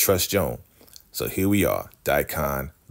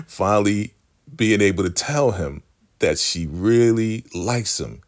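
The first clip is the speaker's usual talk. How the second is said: usual speaking voice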